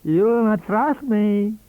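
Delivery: put-on high, slow, wheezy old voice; wheezing old man. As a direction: wheeze it out with sly amusement. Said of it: "he wheezed".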